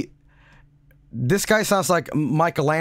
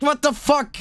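usual speaking voice

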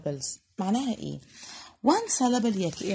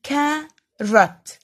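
"carrot" as CA - RROT, slow and deliberate; teacher sounding out "carrot" in two beats; split into two syllables; 5 semitones higher, 4 dB louder than usual. Shown as a demonstration. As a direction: draw out both beats of "carrot". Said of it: "بنطقها على مرتين".

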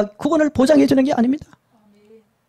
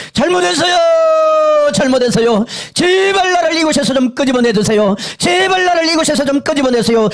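screaming for mercy; 5 semitones higher than usual. Retold or imitated. Soul in the fire begging to be pulled out, shouting. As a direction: yell the plea for release at full voice. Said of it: "고래고래 소리를 지릅니다".

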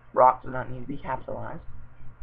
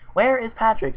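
higher-pitched curious question; 7 semitones higher than usual. A narrator's reading. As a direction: ask it with raised, curious pitch. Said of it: "with curiosity in his voice".

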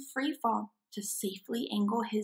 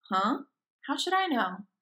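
usual speaking voice

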